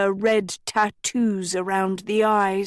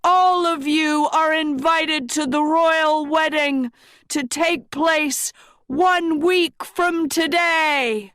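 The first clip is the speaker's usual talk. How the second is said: proclaiming king; 6 semitones higher than usual. A narrator's reading. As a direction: announce it to the whole square. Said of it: "Rian said".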